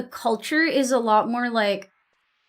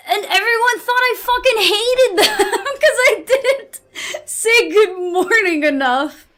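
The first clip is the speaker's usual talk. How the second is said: laughing while talking; 9 semitones higher, 4 dB louder than usual. Real, not acted.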